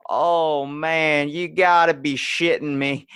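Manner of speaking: loud, high Southern arena announcer. Retold or imitated. Announcer after the Jeep breaks down, Southern accent. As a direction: boom it out in an exasperated Southern accent. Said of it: "That Southern accent is what fucking made it".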